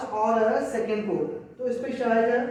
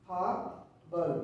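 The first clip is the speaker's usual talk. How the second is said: slow drawn-out reading; 5 semitones lower, 7 dB quieter, much slower than usual. Shown as a demonstration. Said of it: "इसको पढ़ेंगे".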